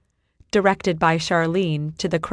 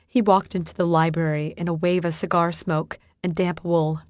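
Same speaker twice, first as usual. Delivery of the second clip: ordinary delivery, nothing marked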